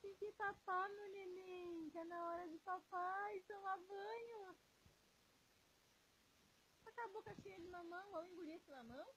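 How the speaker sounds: put-on high, cooing baby-talk voice to a little one; sweet parent baby voice, speeding up on the mouthful line. Parent baby-talking to infant.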